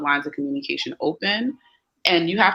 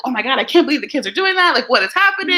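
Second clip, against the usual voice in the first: higher, louder, faster mock panic; panicking parent; 9 semitones higher, 5 dB louder, faster than usual. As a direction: play a parent freaking out. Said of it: "not jump in and be like".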